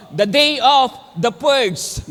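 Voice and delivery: slow, loud, trailer-style call; mock movie-trailer shout; dramatic announcement. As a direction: boom it out like a movie title. Dramatic movie-title line.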